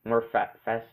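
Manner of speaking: ordinary delivery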